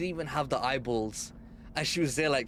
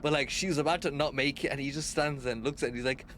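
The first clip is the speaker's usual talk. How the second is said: ordinary talk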